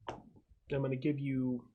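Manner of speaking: normal talking voice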